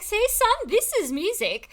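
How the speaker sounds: a high, proud dad-style lecture to her son; mock proud mom. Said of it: "be like".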